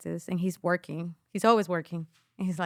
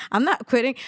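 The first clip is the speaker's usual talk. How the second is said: loud, laughing defiant declaration; laughing defiance; 4 semitones higher, 4 dB louder, faster than usual. Retold or imitated. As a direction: insist through a laugh. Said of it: "I was like".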